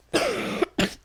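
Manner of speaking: hoarse sick cough; cough from a cold. Real, not acted. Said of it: "j'ai le rhume".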